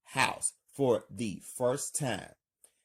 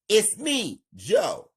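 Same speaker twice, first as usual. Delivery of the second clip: loud, higher call back; yelling; 9 semitones higher, 7 dB louder than usual. Acted. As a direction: shout your name through the door. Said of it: "Joe yells".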